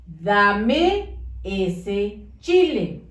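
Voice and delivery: slow, each word separate; careful dictation; slow and clear. Shown as a demonstration. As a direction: dictate slowly to a child. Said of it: "Debe de decir".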